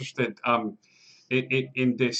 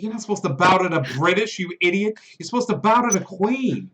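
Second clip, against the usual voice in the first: higher, snappy scolding guard; 6 semitones higher than usual. Acted. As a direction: correct the fool, a touch indignant. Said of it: "I was like".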